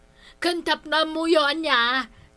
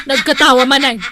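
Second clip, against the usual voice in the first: laughing through the words; 9 dB louder, faster than usual. Acted. Comadre laughing as she notes the laugh. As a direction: giggle while speaking.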